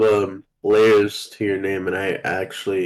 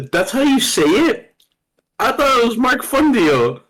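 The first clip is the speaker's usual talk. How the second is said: high-pitched disbelief, voice leaping up; incredulous surprise; 13 semitones higher, 3 dB louder than usual. Real, not acted. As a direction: squeal it, astonished at how it's said. Stunned at learning name's pronunciation.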